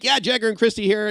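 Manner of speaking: hammed-up radio host hello; mock DJ intro. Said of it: "he wouldn't say that like a joke like I just did".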